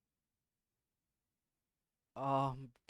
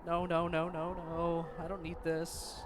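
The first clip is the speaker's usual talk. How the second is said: frightened protest; 3 semitones higher, 5 dB louder than usual. Real, not acted. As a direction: refuse in a fright. Recovering from the scare.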